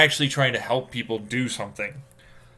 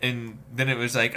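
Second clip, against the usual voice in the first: usual speaking voice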